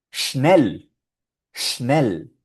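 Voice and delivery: "schnell" with a hushing sh and short e; German schnell. Shown as a demonstration. Teacher models the German word, crisp pronunciation.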